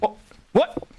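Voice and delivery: high, startled question; sudden disbelief; surprised. Real, not acted. Surprised by the deer.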